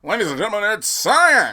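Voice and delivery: booming game show announcer; high, loud hype introduction. Pretend. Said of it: "you have to say it like a forty space spell announcer".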